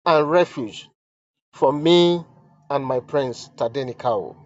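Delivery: solemn dramatic reading; letter read aloud. Acted. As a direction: read each line as if it were the queen's own plea.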